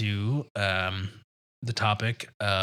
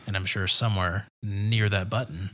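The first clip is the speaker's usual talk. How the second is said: ordinary talk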